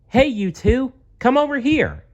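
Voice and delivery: high, loud bully call; bully calling two boys over, high and loud. Acted. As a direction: summon them from down the street.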